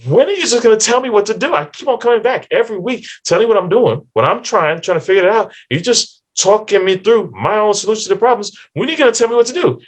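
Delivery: exasperated, wanting answers at last; frustrated client demanding advice from the therapist; fed-up client. Retold or imitated.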